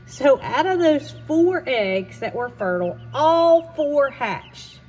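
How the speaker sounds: excited. Real, not acted. Proud reveal of hatched chicks.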